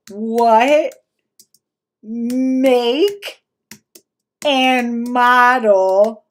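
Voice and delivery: each word stretched out, long pauses; mock a painfully slow typist, loudly; one laboured word at a time; imitating slow typing. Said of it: "he types, like, slow as shit".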